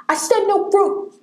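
high-pitched playful outcry; mock indignant protest. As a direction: protest in hammed-up outrage. Mock protest at second fruit question.

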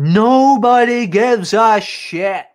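high, loud, drawn-out declaration; emphatic. Real, not acted. Host echoing co-host that nobody cares, slow and emphatic. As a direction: stretch every word for emphasis. Emphatic echo of co-host's line.

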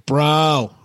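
lazy, dazed stoner voice. Pretend. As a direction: play the cat as a blissed-out stoner. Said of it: "he's just going".

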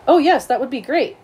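high, eager acceptance; delighted. Acted. Allie is asked to sit together at lunch, eager and delighted.

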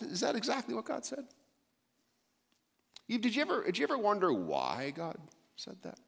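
crafty serpent. Retold or imitated. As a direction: coax her like the serpent planting doubt. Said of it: "He comes out and instead goes".

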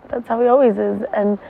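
ordinary delivery

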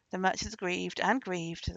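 plain reading voice

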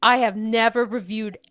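put-on loud, over-the-top dude accent; shouting capitalized words. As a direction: shout it like an all-caps surfer review. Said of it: "gonna read it in all caps... put a little accent on it".

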